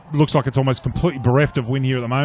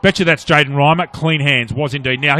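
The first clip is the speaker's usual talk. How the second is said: ordinary talk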